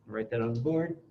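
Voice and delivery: muffled behind a face mask; through a face mask. Real, not acted. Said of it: "with the mask on".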